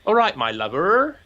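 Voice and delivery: bright lilting Cornish "All right, my lover" with a rise on "right"; Cornish greeting. Shown as a demonstration. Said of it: "They say".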